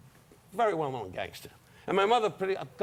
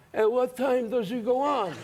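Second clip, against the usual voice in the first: slow, higher mobster voice; gangster at ringside; 7 semitones higher, slower than usual. Retold or imitated. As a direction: put on a slow wiseguy voice. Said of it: "everybody came in and sat ringside".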